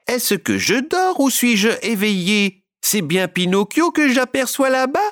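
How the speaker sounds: high, disbelieving puppet voice, rising; wide-eyed, can't believe it; astonished puppet. A narrator's reading. Harlequin asks the audience if he's dreaming, high and amazed. Harlequin stunned, seeing Pinocchio.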